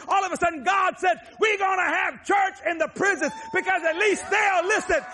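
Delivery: fiery revival preaching; full-force preaching. Real, not acted.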